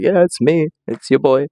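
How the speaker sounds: hyped-up self-intro; cocky hype. Retold or imitated. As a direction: announce yourself like a star. Greeting the witches.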